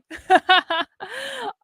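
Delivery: short high laugh after defiance; rueful laugh. Real, not acted. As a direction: laugh through sadness. Laughing off tears.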